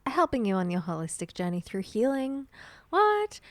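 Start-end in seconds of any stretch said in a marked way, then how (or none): 2.9-3.4 high, loud playful what; mock surprise. Host in a sponsor read, high mock gasp of surprise. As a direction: fake shock for the sponsor.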